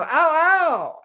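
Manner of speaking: high, loud yelping ow ow; monkey yelps of pain. Retold or imitated. Monkey whose foot is stepped on yelps high and loud. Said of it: "You go".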